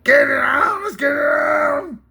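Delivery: hammed-up fight-opening cry; Mills Lane impression. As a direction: bellow it like a ring referee. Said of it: "Mills Lane, the old fight game".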